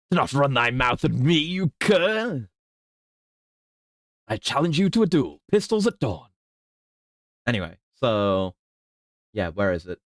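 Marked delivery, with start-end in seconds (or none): 0.1-6.3 higher, indignant fop challenging to a duel; hammed-up huffy aristocrat; offended gentleman. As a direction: slap the glove and demand pistols at dawn. Mock duel challenge, foppish.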